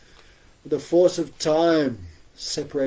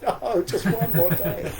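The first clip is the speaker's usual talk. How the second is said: laughing plea; 5 semitones higher, slower than usual. Retold or imitated. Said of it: "I was like".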